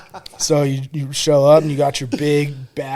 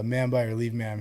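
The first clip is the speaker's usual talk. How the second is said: soft, low-key formal response; understated, hesitant sounding-off; subdued recruit reply; 3 semitones lower, 6 dB quieter than usual. Retold or imitated. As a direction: imitate a recruit saying it meekly. First, timid take of the line.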